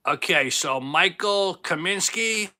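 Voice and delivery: normal talking voice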